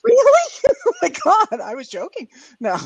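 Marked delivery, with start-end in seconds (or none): 0.0-1.6 high, loud laughing exclamation; amused outburst, pitched way up; real laughing. Real, not acted. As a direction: burst out laughing through the words. Laughing at the teasing.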